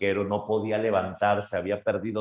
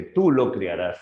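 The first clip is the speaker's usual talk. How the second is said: louder, firm insistence; insistent plea; 6 dB louder than usual. Acted. Boy insists to his grandfather, louder plea. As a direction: press the plea home, louder. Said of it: "le dice".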